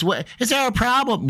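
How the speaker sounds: high-pitched challenge, yelled over speakers; mock-indignant high yell; retold yell. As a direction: imitate her yelling over the speaker boxes. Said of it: "I'll have to yell".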